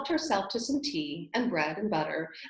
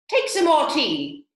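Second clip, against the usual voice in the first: earnest; 8 semitones higher, 9 dB louder, slower than usual. A narrator's reading. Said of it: "very earnestly".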